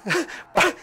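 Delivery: sudden high giggle; short squeal of laughter; high squeaky laugh. Real, not acted. Laughs at screaming goats.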